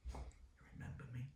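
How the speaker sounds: a hushed whispered you remember me; soft whisper. Retold or imitated. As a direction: breathe the question almost unvoiced. Retelling the teacher reunion question.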